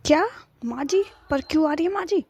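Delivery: high, rising questions of disbelief; startled dismay. Acted. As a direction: react in surprise to unwelcome news. Wife learns mother-in-law is coming. (हैरान होकर ऊँची आवाज़ में सवाल)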